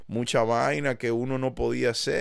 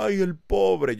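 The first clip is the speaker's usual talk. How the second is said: higher and louder fake sympathetic sigh; mock pity; 10 semitones higher, 5 dB louder, much slower than usual. Voicing the audience pitying him, mock sympathy. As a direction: pity him like a pushover would. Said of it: "yo quiero que ustedes digan".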